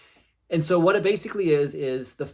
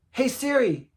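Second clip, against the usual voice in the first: imitated calling out; 8 semitones higher, slower than usual. Imitating daughter calling out to Siri.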